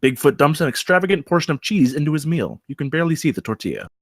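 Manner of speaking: ordinary talk, nothing marked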